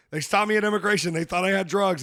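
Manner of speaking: loud, high blurting; agitated retold outburst. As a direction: blurt it out so the whole plane turns.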